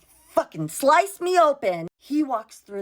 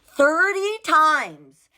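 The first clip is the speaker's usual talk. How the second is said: thirty times drawn out slowly and high; stressed; 8 semitones higher, 5 dB louder, much slower than usual. Real, not acted. Telling viewers how often she called, slow emphatic. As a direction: stretch out Thirty times, indignant.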